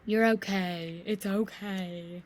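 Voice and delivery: slow, drawn-out mock reassurance; soothing husband imitation. Wife mimics her husband soothing her flat. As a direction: mimic him calming her down. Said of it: "That's what he does".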